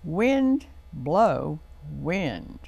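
each word spaced out slowly; slow title. Shown as a demonstration. Host announcing the story title to kids, very slow. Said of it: "that is entitled".

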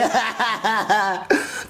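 hammed-up wailing sobs; melodramatic sobbing. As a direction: bawl theatrically. Weeping over cancelled show.

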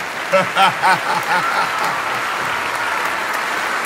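long trailing laugh; long laughter. Real, not acted. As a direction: laugh yourself out of breath. Laughs after a drawn-out all right.

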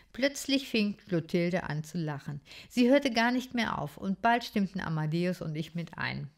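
ordinary delivery

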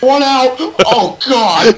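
loud high panic; panicked shout. Real, not acted. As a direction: yell in alarm.

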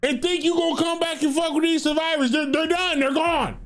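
high-pitched mock scolding, pitched well up; mock exasperated rant. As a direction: rant at the killer like he's hopeless. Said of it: "I'm like".

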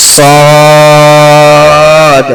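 সদ thick and full, held long; heavy sad. Shown as a demonstration. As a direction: say সদ heavy and drawn out. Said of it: "মোটা করে পড়তে হবে।".

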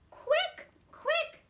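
loud, high urgent yell. Acted. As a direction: hurry them with a shrill cry. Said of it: "yelled the third little pig".

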